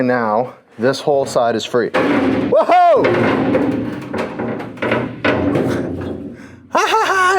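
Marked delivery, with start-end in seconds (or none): 1.9-3.0 sudden high shout of surprise; surprised whoa. Real, not acted. Part suddenly comes free. 3.1-6.3 breathless airy laughter; breathy laugh. Real, not acted. Laughing after the cut works. 6.7-7.4 high, gleeful laugh; triumphant laughing. Real, not acted. Celebrating the cut working.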